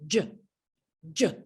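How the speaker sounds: a quick breathy j burst; J sound. Shown as a demonstration. Teacher modelling the J sound, a quick puff. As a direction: puff out a short j. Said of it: "J says".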